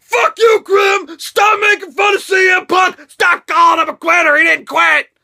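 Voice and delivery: angry fan yelling. Retold or imitated. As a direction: rant back at Grim like a furious fan. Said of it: "You guys get so upset and so emotional and so pissed off".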